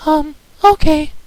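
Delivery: very high, slow, hesitant pony voice; a tiny high voice agreeing nervously; timid Fluttershy. Acted. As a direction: answer shyly, unsure.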